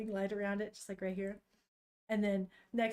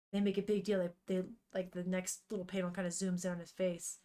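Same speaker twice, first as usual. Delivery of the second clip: normal talking voice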